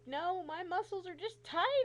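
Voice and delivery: high, loud, slow insistence; exasperated explanation. Retold or imitated. Patient explaining tight muscles to doctor, loudly exasperated. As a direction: spell it out in frustration.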